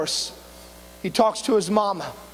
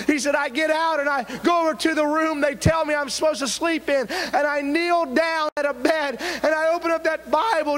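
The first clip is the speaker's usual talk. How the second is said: higher-pitched, driving preacher's testimony; impassioned preaching; 7 semitones higher than usual. Acted. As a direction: build the testimony toward a fervent cry. Preaches a testimony at full force.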